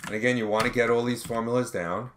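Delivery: normal talking voice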